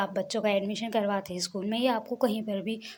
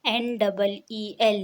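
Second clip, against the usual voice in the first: slow spelled letters; 3 dB louder, much slower than usual. Shown as a demonstration. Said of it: "अंग्रेज़ी में कैसे लिखते हैं नील को". (धीरे-धीरे स्पेलिंग)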